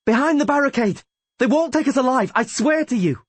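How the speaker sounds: high-pitched, fierce rallying yell; rally the fighters at full voice. A narrator's reading. Rayla swears they won't be taken alive, yelling. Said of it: "yelled Rayla".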